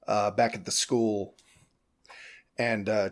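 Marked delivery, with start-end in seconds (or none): none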